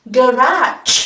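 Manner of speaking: "Garage" as guh-RAZH; stress on second syllable. Shown as a demonstration. Teacher repeating third variant, "Garage" stressed on RAZH. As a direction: reduce the first syllable of "Garage", lean on RAZH. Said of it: "nhấn vào âm số hai".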